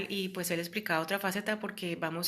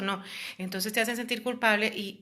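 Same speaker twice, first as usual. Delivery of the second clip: ordinary delivery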